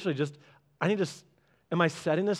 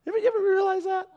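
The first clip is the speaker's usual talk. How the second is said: much higher, fast squeak; a quick playful aside; much faster than usual. Real, not acted. Preacher asks the crowd in a high fast squeak.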